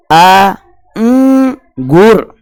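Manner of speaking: अंगूर split into आ, उम, गुर with a hummed nasal उम; sounding out syllables. Shown as a demonstration. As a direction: stretch each piece, humming उम through the nose. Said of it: "इसका उच्चारण करके". (अंगूर को आ, उम, गुर तोड़कर बोलना)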